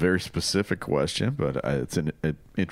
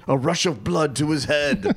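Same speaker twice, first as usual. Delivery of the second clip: higher, louder mock commentator call, laughing; imitating Arlo; 8 semitones higher, 3 dB louder than usual. Host fondly imitating the announcer Arlo's call, laughing. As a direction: ham up the announcer's line through a laugh. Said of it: "I just loved the way he said that".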